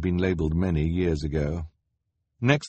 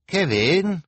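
higher, louder call upward; 11 semitones higher, 5 dB louder than usual. A narrator's reading. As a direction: call up to a sleeper. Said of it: "Hugo called up to the sleeping man".

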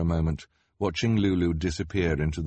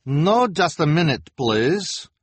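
put-on high, loud accented boss; bossy fortune-teller; Madame Lulu voice; 9 semitones higher, 6 dB louder, slower than usual. A narrator's reading. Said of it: "Madame Lulu said".

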